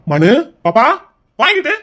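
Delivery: loud, high-rising call; calling out sharply. Acted. Brother summoning his younger sister, calling out sharply.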